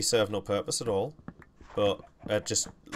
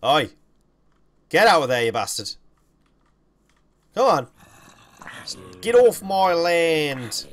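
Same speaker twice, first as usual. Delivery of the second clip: loud angry yell at intruders; shouting; 7 semitones higher, 11 dB louder than usual. Real, not acted. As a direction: bellow them off your land. Shouting at mob in game.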